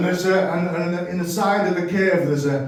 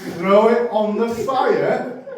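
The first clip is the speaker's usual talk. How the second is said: raised and louder, slowed with a laugh; 5 semitones higher, 5 dB louder, slower than usual. Retold or imitated. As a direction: push it out through laughter. Manager pitching the recipe.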